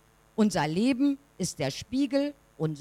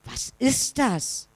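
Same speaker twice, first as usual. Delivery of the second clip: puzzled question; 5 semitones higher, slower than usual. A narrator's reading. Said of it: "und fragt".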